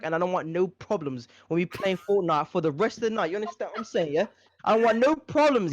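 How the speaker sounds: put-on British accent, scolding; British roadman accent. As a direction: lay down the law in roadman. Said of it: "in that accent for, like, a week straight".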